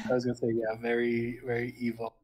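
low evil voice. Acted. Voicing an evil character.

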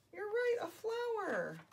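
high, slow, drawn-out praise; delighted agreement. Pretend. Storyteller confirming children's guess, delighted. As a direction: stretch it high in mock discovery.

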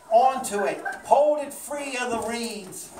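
ordinary delivery

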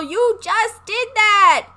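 high, slow, drawn-out praise; real delighted cheer; excited cheer. Real, not acted. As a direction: stretch it out proudly. Cheering her horse's win.

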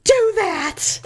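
high, emphatic telling-off; stern scolding. Retold or imitated. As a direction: imitate a rattled driver scolding. Driver scolds her, acted out.

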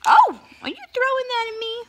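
sudden high loud gasp of surprise; startled, then fast and high; surprised. Real, not acted. Mom startled as toddler throws toy, surprised.

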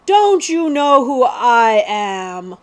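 loud, slow, indignant royal question; snapped, imperious. A narrator's reading. Prince pulls rank on the villains, loud indignant snap. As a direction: draw out each word in outraged pride. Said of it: "snapped Prince Brat".

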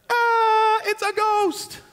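high, drawn-out cry of fright; mock frightened cry. Disciples seeing Jesus on the water, mock terrified cry.

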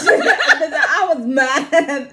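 high, loud, slow through laughter; laughing loud. Real, not acted. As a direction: laugh while getting it out. Laughing retelling his anger.

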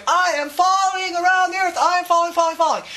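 high, urgent panicked chant; body screaming. Pretend. Your body screams at you in orbit, high panicked wail. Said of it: "your whole body's screaming its head off".

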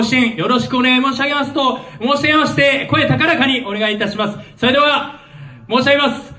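rising, fired-up call to the crowd; rousing rally call. Real, not acted. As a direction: pump the crowd up before the chant. Leader rouses crowd before chant. (気合いを入れて聴衆を盛り上げる声)

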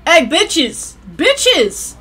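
high, sharp holler; calling out. Real, not acted. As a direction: call them out across the room. Calling out to game characters.

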